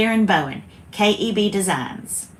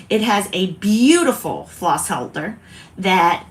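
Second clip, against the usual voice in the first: ordinary talk, nothing marked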